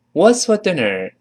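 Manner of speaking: ordinary delivery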